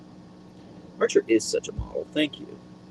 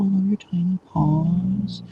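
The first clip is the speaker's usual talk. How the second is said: higher, slow doting coo; slow high pet talk; 9 semitones higher, slower than usual. Real, not acted. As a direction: stretch it out for the dog, pitched up. Cooing to her dog.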